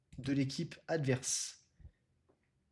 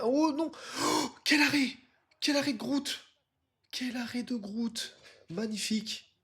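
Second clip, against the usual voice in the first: excited sports commentary; 9 semitones higher than usual. Real, not acted. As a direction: marvel at the keeper's save. Live commentary on a great save.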